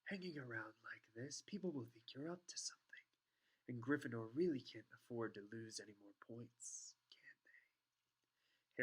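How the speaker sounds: hushed, whispery insinuation; Snape. A narrator's reading. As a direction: breathe the veiled threat almost without voice. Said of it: "said Snape".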